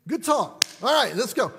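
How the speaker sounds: clipped mock-cheerful send-off; sarcastic dismissal. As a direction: wrap it up with dry sarcasm. Coach's sarcastic brush-off.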